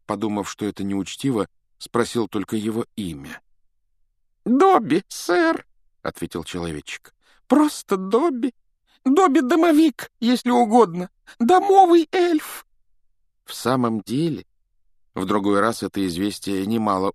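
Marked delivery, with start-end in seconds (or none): 4.5-5.7 very high, squeaky elf voice; eager little house-elf, squeaking. A narrator's reading. 7.5-12.7 very high, squeaky elf voice; eager little house-elf, squeaking. A narrator's reading.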